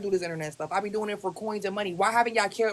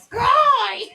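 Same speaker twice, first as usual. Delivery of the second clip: loud high call across the room; shouting a name; 13 semitones higher, 9 dB louder than usual. Real, not acted. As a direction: yell the name out. Calling out excitedly.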